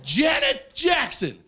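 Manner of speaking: high, drawn-out excited disbelief; mock starstruck shout. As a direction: exclaim the name slowly, way up high. Starstruck reaction to the name.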